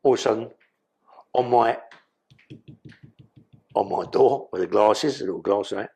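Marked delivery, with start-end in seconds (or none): none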